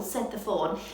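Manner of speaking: ordinary delivery, nothing marked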